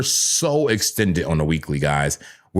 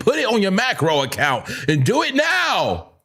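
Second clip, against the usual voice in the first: yelling at himself; 12 semitones higher than usual. Acted. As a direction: bark it at yourself like a drill sergeant. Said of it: "Stop yelling".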